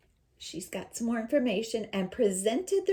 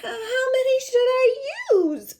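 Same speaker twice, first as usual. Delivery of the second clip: put-on high, loud, uncertain child; little girl voice; 10 dB louder than usual. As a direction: ask timidly like a small girl. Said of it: "my voice changed to kind of sound".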